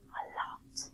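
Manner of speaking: whispered aside to the class. Real, not acted. Teacher whispering an aside to students. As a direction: drop to a whisper.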